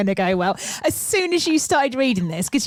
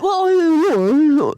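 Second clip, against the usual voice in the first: calling whoa; 5 semitones higher, 6 dB louder than usual. Retold or imitated. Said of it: "all I can hear is like".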